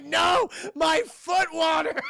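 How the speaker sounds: slow, high, loud anguished wail; a hammed-up heartbroken cry; mock wail. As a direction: ham up the loss of the water. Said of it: "He was so sad about that".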